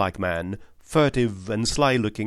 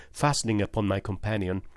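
usual speaking voice

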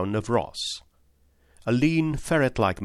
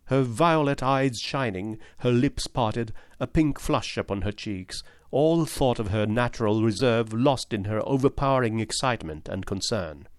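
ordinary delivery, nothing marked

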